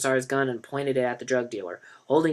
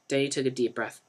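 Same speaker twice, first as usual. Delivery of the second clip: ordinary delivery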